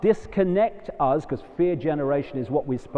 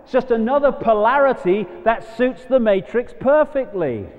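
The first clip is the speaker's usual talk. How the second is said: louder and higher, hammering every not; emphatic declaration; 6 semitones higher, 4 dB louder than usual. Real, not acted. To the audience, rejecting the shootout, a loud emphatic declaration. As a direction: preach it hard, stressing not. Passionate rally against violence.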